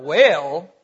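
well drawn out as a Southern weyl with a rise. Shown as a demonstration. Preacher mimicking his own Southern well. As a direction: drawl the word well Southern style. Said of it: "That's a good Southern accent there".

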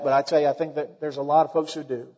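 ordinary delivery

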